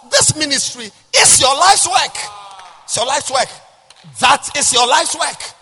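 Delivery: loud, high, driving sermon cry; full-force preaching. Acted. Pastor crying out to the congregation. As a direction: hammer the point home to the pews.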